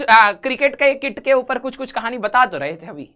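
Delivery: ordinary talk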